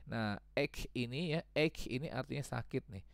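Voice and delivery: usual speaking voice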